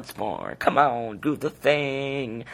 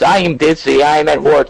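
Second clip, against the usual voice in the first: loud, higher mock of his excuse; yelled mocking quote; 4 semitones higher, 11 dB louder, faster than usual. Real, not acted. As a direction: throw his words back in a yell. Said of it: "doing a little bit of yelling".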